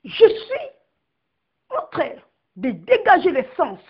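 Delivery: normal talking voice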